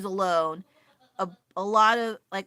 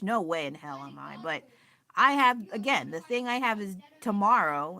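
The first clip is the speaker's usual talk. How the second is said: normal talking voice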